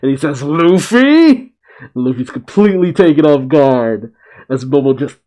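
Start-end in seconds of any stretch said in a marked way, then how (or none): 0.4-1.5 high, rising mock-furious call; angry outcry. A narrator's reading. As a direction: shout the name high, acted outrage. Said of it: "pissed off face".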